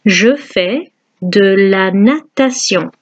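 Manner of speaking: Je fais de la natation slowly and clearly; slow French model. Shown as a demonstration. Teacher slowly modelling the sentence for students.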